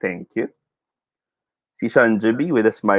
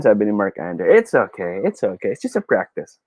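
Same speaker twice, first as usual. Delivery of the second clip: usual speaking voice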